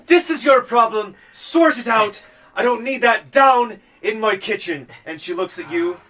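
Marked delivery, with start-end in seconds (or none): 0.1-4.9 louder, higher, sharp Tabalan voice; fed-up cook. Acted. Chrysitha scolding the crew about her kitchen. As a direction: tell them off in a Tabalan accent. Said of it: "She looks at you all and goes".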